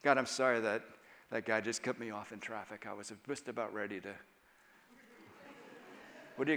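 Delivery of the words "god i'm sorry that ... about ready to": soft, low confession to God; hushed repentant prayer; quiet prayer. Shown as a demonstration. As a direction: pray it softly and humbly.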